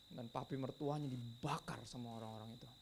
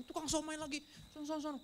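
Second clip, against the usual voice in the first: high-pitched comic shooing; hammed-up high fussy voice; mock scolding; 14 semitones higher than usual. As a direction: keep it high and silly, shooing them away. Mimics shooing a food vendor.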